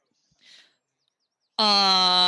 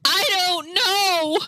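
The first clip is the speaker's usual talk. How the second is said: high, drawn-out mock cry; hammed-up bewildered wailing; panicked wail; 8 semitones higher, much slower than usual. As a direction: wail it slowly in confusion. Acted panic on coming to senses.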